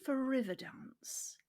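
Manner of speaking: whispered aside; whispering. Real, not acted. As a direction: whisper it confidentially.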